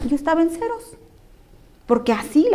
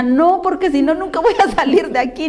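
high, louder mock-tearful wail, edged with a laugh; imitated crying; 5 semitones higher, 3 dB louder than usual. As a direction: imitate your own crying plea, half laughing. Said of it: "Y yo llorando le decía". (imitando un llanto agudo, con algo de risa)

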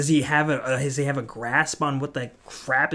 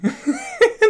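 high, embarrassed laugh; 6 dB louder than usual. Real, not acted. Laughing at being the story in every class.

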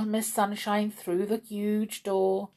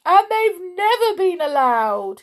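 high, loud hammed-up sobbing; sobbing wail; 12 semitones higher, 8 dB louder than usual. A narrator's reading. King explains through tears, mock sobbing. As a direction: bawl it out tearfully. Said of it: "he sobbed".

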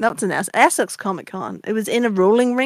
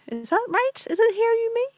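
unsure high query; 14 semitones higher, 5 dB quieter than usual. Real, not acted. Unsure of song title.

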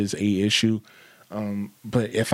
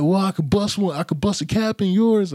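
high-pitched mimicked threat; mock the blustering driver, much higher; imitated trash talk; 9 semitones higher than usual. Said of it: "talking all this shit".

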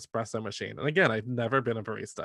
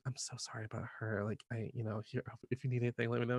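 much softer, hushed and apologetic; quiet apology; 2 semitones lower, 13 dB quieter, faster than usual. Retold or imitated. Barista quietly apologizes to the customer about her boss.

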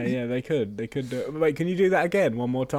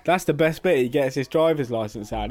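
usual speaking voice

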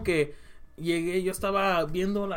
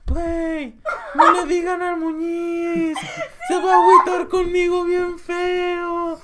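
súplica lastimera más aguda y lenta; borracha suplicando; 9 semitones higher, 4 dB louder, much slower than usual. Retold or imitated. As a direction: imita a la amiga borracha rogando, arrastrando las palabras. Imita a Sam borracha suplicando. (Sam borracha le ruega a Muñiz que no le digan, voz aguda y lastimera)

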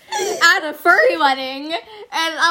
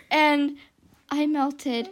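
ordinary talk, nothing marked

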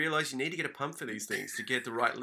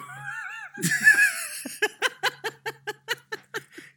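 high shrieking burst of laughter; 4 dB louder than usual. Real, not acted. Seeing the sad balloon snake: high shrieking laughter. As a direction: explode into a screechy laugh.

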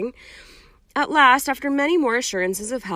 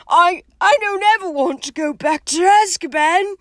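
high, loud, stammering plea; tearful dread; 11 semitones higher, 9 dB louder than usual. Acted. Hagrid never wanting Azkaban again, tearful and afraid. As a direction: plead it as if near tears, pitched high.

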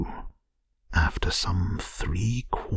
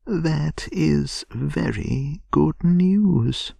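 slow, higher and louder, mildly solemn; grave; 10 semitones higher, 5 dB louder, much slower than usual. A narrator's reading. Mole receiving news of Toad's repentance, gravely. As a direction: draw it out with earnest weight. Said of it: "said the Mole gravely".

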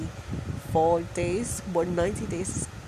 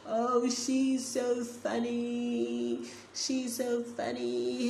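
high, slow teasing singsong; mocking; 6 semitones higher, 3 dB quieter, much slower than usual. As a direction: tease in a high, drawn-out voice.

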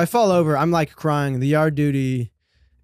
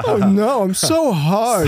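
cowardly lion voice; 4 semitones higher, 5 dB louder, slower than usual. Acted.

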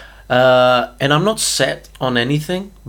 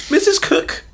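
put-on much higher old lady call; squeaky grandma voice. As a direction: pitch it way up like a granny. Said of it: "It's Tim Cook's grandma".

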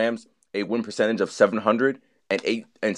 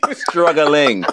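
louder, higher mock slurred struggle; Joe Namath; 5 semitones higher, 7 dB louder than usual. Podcast host mimics a football legend on struggling, loud mocking impression. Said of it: "Joe Namath's voice".

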